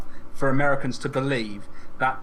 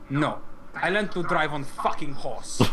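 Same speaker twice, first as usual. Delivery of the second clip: sarcastic Greek student; slower than usual. Retold or imitated. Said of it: "And she went".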